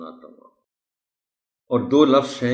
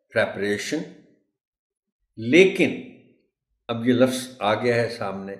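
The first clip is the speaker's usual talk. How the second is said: ordinary delivery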